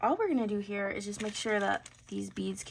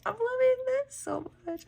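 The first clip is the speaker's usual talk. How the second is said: excited squeal; 5 dB louder than usual. Real, not acted. Gushing over the finished tassels.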